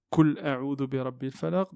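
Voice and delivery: "Kul" with a plain front k; wrong kaf reading. Shown as a demonstration. Teacher demonstrates the grave mistake of a kaf in the verse. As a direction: read "Kul a'udhu" with the mistake. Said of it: "c'est une erreur très grave".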